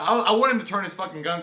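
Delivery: usual speaking voice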